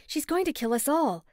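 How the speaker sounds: high, panicked warning; frightened plea. A narrator's reading. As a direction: plead in fear. Urgent warning to Colton.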